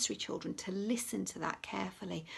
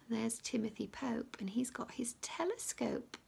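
hushed aside pointing at the picture; whisper; 9 dB quieter than usual. Acted. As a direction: whisper while showing the page. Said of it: "showing picture to children".

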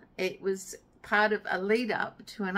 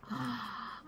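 soft gasp; 2 semitones higher, 9 dB quieter than usual. Real, not acted. Seeing the yarn.

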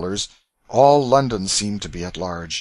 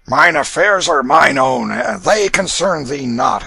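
slow, loud gruff retort; rough retort; 6 semitones higher, 9 dB louder, slower than usual. A narrator's reading. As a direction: snap it back roughly. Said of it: "answered Canty roughly".

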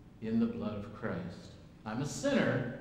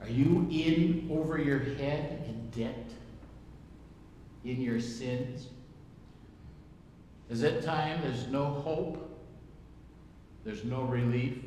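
slow rhetorical preaching; slower than usual. Acted.

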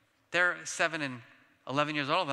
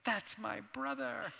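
higher, slow, softer proud sigh; proud brother; 8 semitones higher, 5 dB quieter, slower than usual. Retold or imitated. Brother watching the talk, slow, softer, higher and proud. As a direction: beam with mock pride over him. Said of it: "my brother was like".

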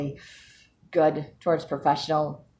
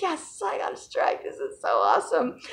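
retold excited squeal; 9 semitones higher than usual. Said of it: "jumping up and down and screaming".

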